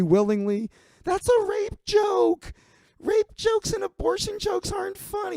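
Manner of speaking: very high, shrill panicked woman's squeal; shrieking Sarah. Retold or imitated. Sarah shrieks at Big Mike chasing her.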